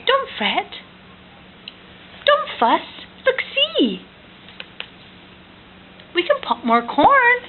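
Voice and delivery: very high, squeaky mouse; tiny mouse voice. Acted. Parent voicing the mouse for toddler, high and squeaky.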